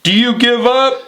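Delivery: mock Shamrock yelling; Shamrock shouting. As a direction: bark it into his ear. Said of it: "Shamrock's like".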